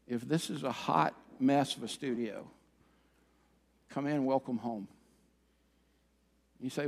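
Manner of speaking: soft, low pastoral appeal; heartfelt sermon close. Acted. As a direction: bring it right down, quiet and sincere. Preacher's gentle, earnest closing appeal.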